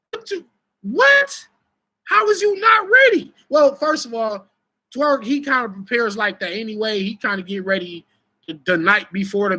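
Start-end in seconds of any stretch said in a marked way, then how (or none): 0.1-3.3 mock outraged how-could-you shout; disbelief. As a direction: demand it in disbelief, voice jumping up. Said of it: "'Cause I'm sitting here like".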